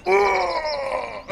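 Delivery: high, drawn-out effort groan; straining groan. Acted. Game master mimes lifting the wagon, long straining groan. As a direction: strain as if heaving a great weight.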